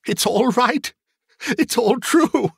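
very high, giddy cries breaking into laughter; exultant laughter. Acted. Scrooge finds that it all happened, laughing exultantly. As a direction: climb to a long helpless laugh.

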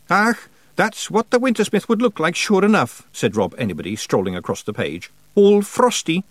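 cheeky little Scots fighter; Scottish pictsie. A narrator's reading. Rob Anybody remarking on the picture in a Scottish voice.

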